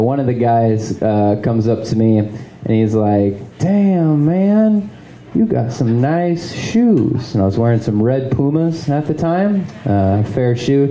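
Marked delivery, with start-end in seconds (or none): 3.6-7.3 high, drawn-out admiration; impressed guy admiring someone, pitched up; admiring stranger. Retold or imitated. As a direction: imitate an impressed stranger in a raised voice.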